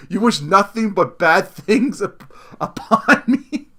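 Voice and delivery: slow read breaking into laughter at upon; reading aloud, cracking up; laughing while reading. Real, not acted. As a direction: giggle through the last word. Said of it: "rereading the email, laughing".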